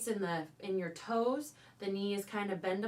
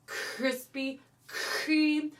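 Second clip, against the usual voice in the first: Krispy Kreme drawn out very slowly; slow, wrong way; 3 semitones higher, much slower than usual. Shown as a demonstration. Teacher showing the wrong slow way, drawn out. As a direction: stretch out Krispy Kreme lazily. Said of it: "So it's not".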